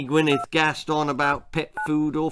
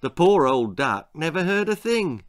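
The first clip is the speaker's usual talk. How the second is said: ordinary delivery, nothing marked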